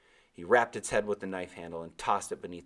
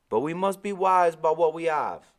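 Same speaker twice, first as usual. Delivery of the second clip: put-on high, loud island-accented old man; old Caribbean sailor; 10 semitones higher, 10 dB louder than usual. Old sailor answering the boy, Caribbean accent. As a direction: give Timothy his lilting Caribbean voice. Timothy speaks.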